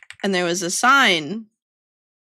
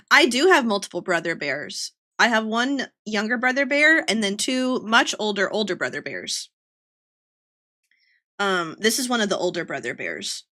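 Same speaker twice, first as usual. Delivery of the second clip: normal talking voice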